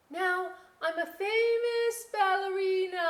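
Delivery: pretend ballerina. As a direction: pose grandly as a star. Girl pretending, sing-song.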